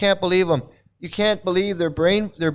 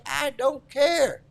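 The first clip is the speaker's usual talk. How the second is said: slow, high, drawn-out sneer; slow high mocking whine; mock indifference; 9 semitones higher, 4 dB quieter, much slower than usual. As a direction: stretch it out like a careless follower. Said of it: "They're just like".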